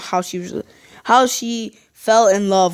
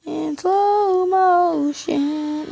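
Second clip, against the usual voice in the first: very slow, drawn-out high slow-motion voice; mock slow-mo voice; 7 semitones higher, much slower than usual. Gamer acting out the replay, slow drawn-out voice. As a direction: stretch every sound like a slowed replay. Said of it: "I wanna see it in slow-mo".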